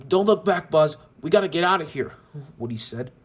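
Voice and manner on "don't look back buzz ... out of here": louder, higher urgent call; urgent shout. Acted. Woody urging Buzz to flee, urgent and loud. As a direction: hurry him along in alarm.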